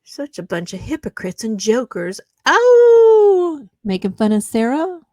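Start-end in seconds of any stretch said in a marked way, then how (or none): 2.5-5.0 sudden high, loud sarcastic "Oh"; a big fake gasp of shock; shrill sarcastic squeal of surprise; mock surprise.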